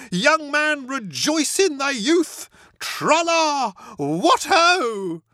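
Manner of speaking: high, loud, grand and slow rejoicing; Motty preaches youthful joy to Bertie, high and loud; mock preaching. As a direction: intone it like a jolly sermon, then sing out.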